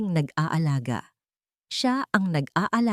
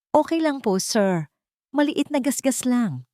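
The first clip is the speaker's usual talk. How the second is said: put-on higher, polite young woman's voice; higher female voice, respectful and light; 6 semitones higher than usual. A narrator's reading. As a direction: brush it off politely to the boss, pitched up. Rihanna answers her boss.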